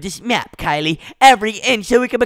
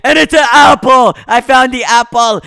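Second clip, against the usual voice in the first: louder, higher shout of discovery; excited shout; 4 semitones higher, 7 dB louder than usual. Real, not acted. Kid finding the apple, shouting to friend excitedly. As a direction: yell it out, thrilled to find it.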